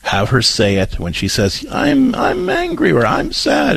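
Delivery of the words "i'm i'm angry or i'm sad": put-on much higher, slower small-child voice saying the feeling words; little child's voice. Parent voicing her four-year-old daughter, a much higher little child's voice.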